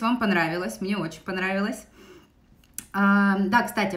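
ordinary talk

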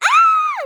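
very high, loud shriek; panicked scream. Pretend. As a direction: shriek at sudden danger. Danger in game. (tiz çığlık)

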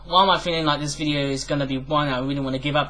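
normal talking voice

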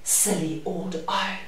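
ordinary delivery, nothing marked

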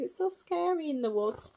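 plain reading voice

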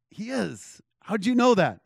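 high-pitched imitation of an amazed woman; amazed, disbelieving quote; retold astonishment.

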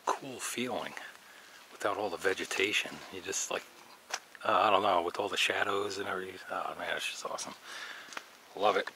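ordinary talk

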